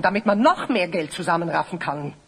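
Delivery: ordinary talk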